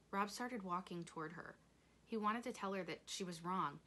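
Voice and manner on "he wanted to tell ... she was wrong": ordinary delivery, nothing marked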